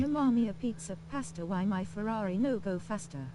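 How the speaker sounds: hammed-up Italian accent; jolly italian pizza chef. Italian stereotype impression.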